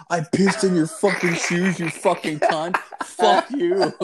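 high, hammed-up triumphant yell; gloating taunt. Storyteller confronting the girl at school, gloating.

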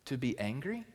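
hushed searching question; God's gentle question. Acted. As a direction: ask it quietly like a patient father. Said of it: "He says".

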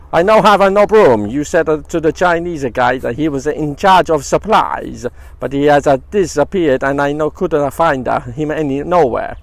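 a jokey Italian man, loud and put out; Italian accent. Retold or imitated. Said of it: "I thought it was Gino D'Acampo".

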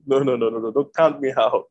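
ordinary delivery

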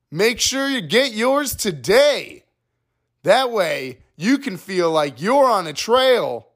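high, bright parody commercial voice, sing-song; mock ad read. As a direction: sell trail mix like a cheesy radio ad. Mock ad read for Trail Mix.

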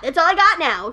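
ordinary delivery

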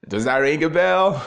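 high, loud eager question; a man sure he'll be recognised, asking excitedly. Retold or imitated. Proud man asks old woman, high and loud with excitement. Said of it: "he was so excited".

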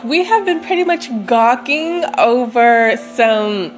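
ordinary talk, nothing marked

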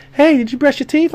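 high, sweet grandma question; a lightly voiced grandma checking on him; grandma calling. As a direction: put on grandma's voice to ask.

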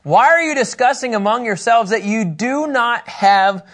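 slow, weighty rebuke of the disciples; Jesus rebuking. Retold or imitated. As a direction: draw it out like a patient but exasperated teacher. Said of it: "Jesus said in verse eight".

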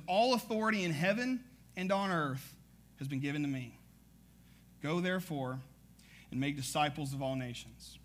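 measured Bible reading voice; scripture reading. Acted. Reads scripture aloud.

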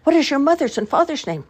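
high, questioning village women; coaxing women. A narrator's reading. Village women ask the child her name, high and coaxing. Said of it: "they said".

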